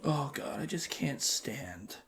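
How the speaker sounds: whispered confession; hushed gossip about Nick. Real, not acted. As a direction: whisper it so nobody hears. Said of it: "whispered gossip".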